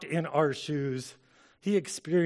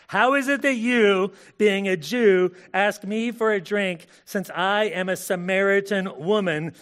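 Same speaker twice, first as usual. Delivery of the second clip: Samaritan woman voice; 6 semitones higher, 9 dB louder, slower than usual. Acted.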